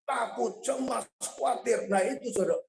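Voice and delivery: ordinary talk, nothing marked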